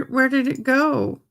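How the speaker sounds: usual speaking voice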